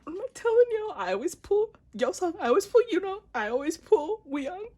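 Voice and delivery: high, loud, insistent squeal; excited yelling. Real, not acted. Fan telling viewers about the predicted pull, excited yelling. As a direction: shout it in giddy triumph.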